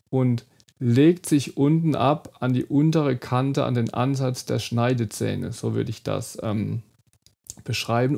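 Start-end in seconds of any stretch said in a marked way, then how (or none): none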